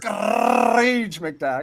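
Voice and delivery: "Scrooge" with an exaggerated long trilled R; rolled Scottish R. Shown as a demonstration. Topping the friend's try, rolled Scottish R. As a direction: roll that R as long as possible. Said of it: "You say".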